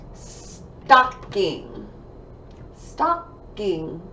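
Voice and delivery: "Stocking" split with a gap between stock and ing; syllable by syllable. Shown as a demonstration. Teacher counting syllables for pupils, "Stocking" broken in two. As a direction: clap out "Stocking" in two separate beats. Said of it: "Two syllables".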